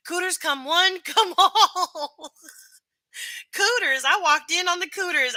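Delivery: high, loud, laughing barker call; giddy hype. Real, not acted. Streamer hypes the cooter festival to chat like a laughing barker.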